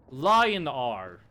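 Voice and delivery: "Lion R" said as two words, lion then the letter R. Shown as a demonstration. Streamer mock-teaching chat to say "Lion R". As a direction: spell out "Lion R" like a big cat and a letter. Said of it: "It's pronounced lion R".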